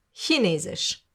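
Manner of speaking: ordinary talk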